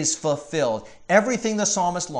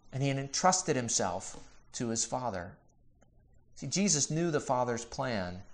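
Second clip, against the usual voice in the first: ordinary talk, nothing marked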